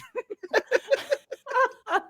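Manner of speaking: long high-pitched laugh. Real, not acted. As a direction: let out a high falling laugh. Laughing at accent joke.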